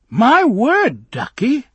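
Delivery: high, loud cry of a fussy nurse; startled old minder. Acted. Old minder catching the girls in the garden, high and loud. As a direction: call out like a scandalised nanny.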